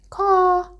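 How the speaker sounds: high sharp crow call; crow's caw. A narrator's reading. Crow greets Luna on the branch, high caw. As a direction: caw like a bird. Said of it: "said the crow should have said".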